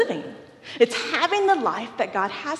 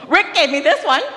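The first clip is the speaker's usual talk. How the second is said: laughing retelling; 6 semitones higher, 5 dB louder, faster than usual. Retold or imitated. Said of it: "He's like".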